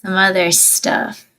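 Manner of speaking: ordinary talk, nothing marked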